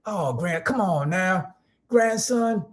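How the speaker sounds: put-on high-pitched old-school grandpa voice; grandfather's old-time twang. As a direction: goad a grandson in an imitated elder twang. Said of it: "Yeah, that's how, that's how he's".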